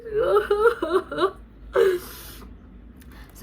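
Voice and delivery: high laugh close to crying; tearful laugh. Real, not acted. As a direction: laugh through happy tears. Laughing while nearly crying with joy.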